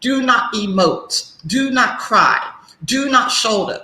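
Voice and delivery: slow, each NOT stressed; stern slow commands. Retold or imitated. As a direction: lay down rules word by word. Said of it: "we are told to clamp ourselves down".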